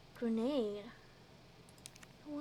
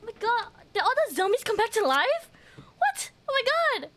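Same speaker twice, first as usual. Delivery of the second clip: high, loud, astonished outburst; shocked disbelief; 6 semitones higher, 5 dB louder, faster than usual. Real, not acted. Streamer finding the zombies alive again, shocked disbelief. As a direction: exclaim it fast, pitch jumping on the reactions. Sees the zombies have revived.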